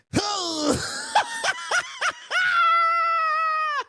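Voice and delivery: high, wailing burst of laughter; helpless laugh that sounds like crying; shrieking laugh. Real, not acted. Viewer watching the knife hit the eye wails with laughter.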